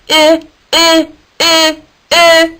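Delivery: high, loud put-on beeps; beeping. Isaac the iguana waking Harold, loud beeps. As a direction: beep like a little alarm. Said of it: "Isaac beeped at him".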